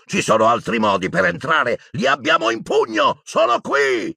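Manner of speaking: high-pitched, mounting urgent yell; man shouting. A narrator's reading. A Death Eater calls the others over, shouting. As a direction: shout it to rally the attackers. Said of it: "sentì gridare una voce d'uomo".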